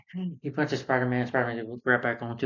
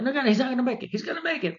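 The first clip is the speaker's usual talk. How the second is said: very high, hammed-up suspense; mock panic; 13 semitones higher, faster than usual. Viewer fretting over Spider-Man's rescue, high mock panic. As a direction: squeal it in pretend worry.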